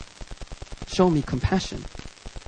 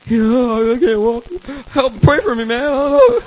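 hammed-up helpless wail; drunk sick friend; 6 semitones higher, 4 dB louder, slower than usual.

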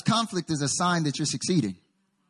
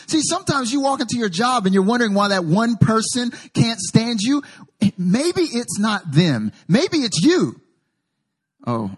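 louder, driving sermon cadence; full-force preaching; 4 semitones higher, 6 dB louder than usual. Acted. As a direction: preach it to the whole room.

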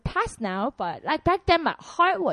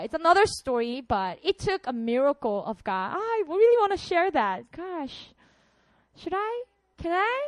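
ordinary talk, nothing marked

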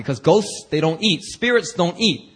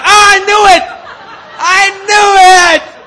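much higher, much louder "I knew it"; triumphant shout; 14 semitones higher, 13 dB louder than usual. Pretend. Believers at resurrection, shouted triumph. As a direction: yell it like you won. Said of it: "Everybody gonna be like".

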